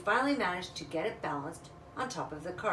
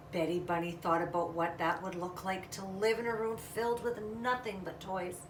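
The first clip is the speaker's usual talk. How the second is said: plain reading voice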